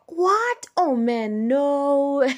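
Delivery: mock dismayed cry, high and loud; disappointed Sebastian Vettel. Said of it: "a very disappointed Sebastian Vettel".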